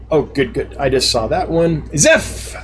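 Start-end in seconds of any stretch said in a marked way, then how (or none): 2.0-2.5 sudden high-pitched exclamation; excited name call. Real, not acted. Streamer spotting a viewer's name in chat, excited call. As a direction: burst out brightly with the name, short. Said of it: "I was very excited to say that name".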